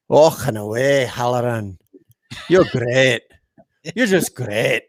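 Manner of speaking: attempted Indian accent. Shown as a demonstration. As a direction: show off a practised Indian accent. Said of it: "That was my Indian accent".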